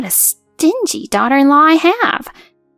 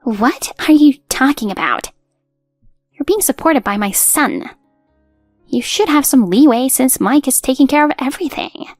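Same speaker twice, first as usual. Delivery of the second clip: mild acted yell, moderately raised; indignant outburst, kept in check; furious yelling; 4 semitones higher, 3 dB louder than usual. Mother-in-law berates daughter-in-law, moderately furious. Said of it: "She got furious, turning red and yelling".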